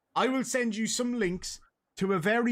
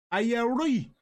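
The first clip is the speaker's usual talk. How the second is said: higher-pitched North Dublin, T dropped from "right"; North Dublin accent; 6 semitones higher than usual. Shown as a demonstration. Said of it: "So in the North".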